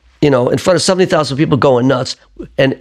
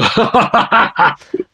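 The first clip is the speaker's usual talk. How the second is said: high, sheepish laugh; embarrassed giggle on stage; 10 semitones higher than usual. Retold or imitated. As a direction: laugh it off like you're caught out. His reaction to the fan.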